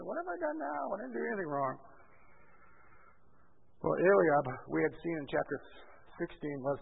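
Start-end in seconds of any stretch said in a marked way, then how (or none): 0.1-1.8 put-upon younger brother. Acted. Younger brother protesting to his older brother, aggrieved. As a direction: complain like a kid brother wrongly blamed. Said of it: "just like a younger brother to an older brother".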